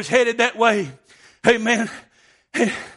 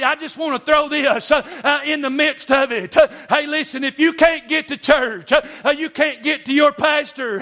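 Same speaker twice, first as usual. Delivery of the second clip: higher-pitched, driving revival cadence with gasped breaks; full-force preaching; 5 semitones higher than usual. Real, not acted. Preacher urging dads to stand their ground, full-force preaching. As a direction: preach hard in short rhythmic bursts, punctuated with "amen".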